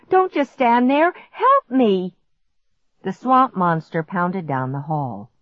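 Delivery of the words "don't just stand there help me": higher, urgent demand for help; desperate pleading. A narrator's reading. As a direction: urge a frozen brother into action. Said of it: "I pleaded".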